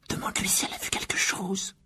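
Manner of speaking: souffler les mots sans voix; chuchotement presque muet. Acted. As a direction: message chuchoté, à peine articulé. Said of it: "en remuant les lèvres silencieusement". (chuchoter du bout des lèvres)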